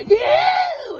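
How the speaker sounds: high, loud whoop of Yeah; joyful yell. Retold or imitated. Happy when God makes him happy, loud high yell. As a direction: shout it up to the sky. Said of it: "I go".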